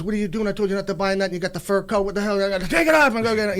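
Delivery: Goodfellas gangster. Retold or imitated. Mobster scolds his crew over a fur coat, imitated rant. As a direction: imitate a movie gangster telling someone off. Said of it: "Goodfellas, and the guy, he says".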